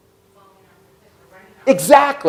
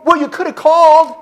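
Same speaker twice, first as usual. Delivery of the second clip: higher, louder mock reproach; reproachful wife; 10 semitones higher, 5 dB louder than usual. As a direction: throw it at him like a hurt wife. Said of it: "what does she hear?".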